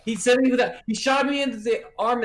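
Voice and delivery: wounded man blaming the crossbowman; angry complaint. Acted. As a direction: point at him and complain.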